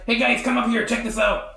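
lightly acted yell from up a ladder; mock yell. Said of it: "You hear him yelling down from there".

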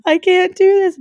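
high, loud panicky whine; mock nervous panic. Recalling fear of talking videos, mock nervous panic. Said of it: "I was so nervous to do them".

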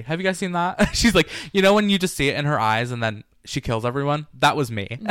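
ordinary delivery

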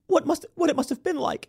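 high, mock tearful sympathy; gushing Drew Barrymore. Mocking Drew Barrymore kneeling before her guest, high tearful parody. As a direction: ham up an overwhelmed host. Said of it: "gets on her knees and is like".

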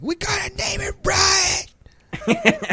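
high, drawn-out strangled mock yell; imitated kid yelling. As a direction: squeal it slowly like an insistent kid, held back in volume. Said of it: "like, yelling".

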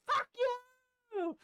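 very high screeched retort; retold screaming. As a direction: act out screaming down at them. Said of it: "I'm screaming down there".

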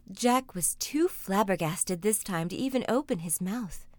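ordinary delivery

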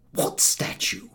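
whispered innocent question; feigned innocence. A narrator's reading. Harris pretending to see nothing, whispered. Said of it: "said Harris".

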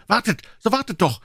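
put-on high urgent boyish call; high-pitched pleading yell; bellowing after them. A narrator's reading. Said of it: "denselben nachbrüllend".

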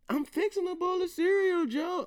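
mock the daughter in a squeaky put-upon voice; imitating daughter. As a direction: daughter annoyed at dad, high whiny imitation. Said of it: "pop up on her".

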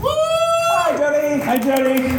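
excited shout. Real, not acted. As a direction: call out happily to someone far away. Greeting remote panelist on monitor.

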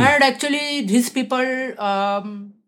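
Uttar Pradesh accented English; central Indian accent. Shown as a demonstration. Actress giving an accent class on request, Uttar Pradesh accent. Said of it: "It's accent from middle central India".

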